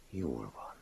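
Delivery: very soft, hushed assent; gentle. A narrator's reading. Master calming the self-reproaching man, gentle. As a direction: accept it quietly. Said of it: "folytatta szelíden a mester".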